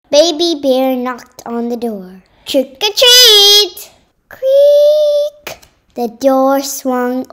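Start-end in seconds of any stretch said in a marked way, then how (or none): 2.5-3.9 high, loud, slow call through the door; calling out trick or treat. Acted. Baby Bear calls at the door. 4.3-5.6 high, drawn-out creak of a door; creaky door. Acted.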